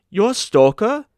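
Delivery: high, loud and thrilled; delighted cry. A narrator's reading. Carl thrilled to meet a real stoker, delighted cry. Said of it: "cried Carl delightedly".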